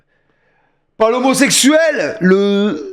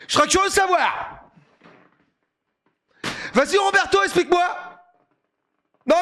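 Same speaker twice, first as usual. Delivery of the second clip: mocking challenge; 8 semitones higher, 7 dB quieter than usual. Mocking the coach after a goal.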